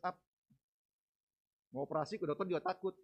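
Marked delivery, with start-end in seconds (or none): none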